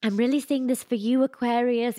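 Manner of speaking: wobbly mock-British accent, gently encouraging; sweet British accent. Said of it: "really sweet".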